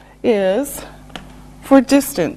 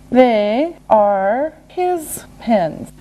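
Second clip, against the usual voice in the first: word by word, very slowly; slow and deliberate; slower than usual. Shown as a demonstration. Teacher modelling an answer for learners, slow and separated.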